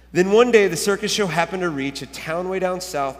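animated read-aloud with swelling emphasis; storybook reading. A narrator's reading. Pastor reads a children's book to church, animated storytelling.

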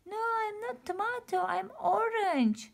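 high, loud, correcting indignantly; indignant Orange. Acted. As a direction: insist on your real name. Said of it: "said Orange".

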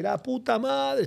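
slow, high, drawn-out curse; a cabbie fuming after being rear-ended, dragging out the curse; imitating furious taxi driver. Angry taxi driver after crash.

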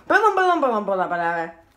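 ordinary talk

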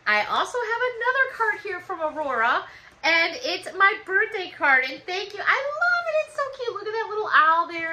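gushing over her birthday card; real squealing excitement; excited. Real, not acted.

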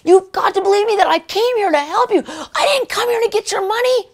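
high-pitched, fervent revival preaching; preaching with all my might. Retold or imitated. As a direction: preach like you're drilling it into them. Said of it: "I'm preaching it with all my might".

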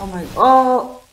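high, loud yelp of surprise; startled cry. Real, not acted. His car crashes, startled loud cry. Sudden crash during race.

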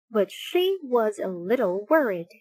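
ordinary delivery, nothing marked

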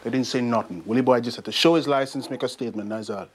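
put-on brisk Jamaican-accented report; easy, matter-of-fact Jamaican voice. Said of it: "I can do a Jamaican accent".

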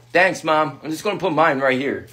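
an indifferent big brother; teen brother voice. Acted. Brother takes his stocking, low.

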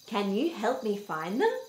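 ordinary delivery

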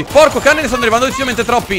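high, fast burst of alarm. Real, not acted. Player sees too many zombies coming, alarmed. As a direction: blurt it as the horde closes in.

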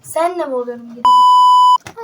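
loud, high annoyed yell; angry shout. Real, not acted. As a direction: snap back loudly at your sister. Sister snaps at teasing sibling.

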